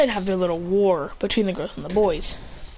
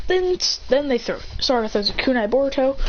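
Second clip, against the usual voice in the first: plain reading voice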